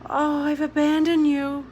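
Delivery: a tearful woman blaming herself; tearful lament. A narrator's reading. Miss Smith to Ada, tearful and self-reproaching.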